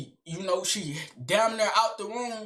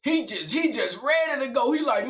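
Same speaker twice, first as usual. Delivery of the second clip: ordinary delivery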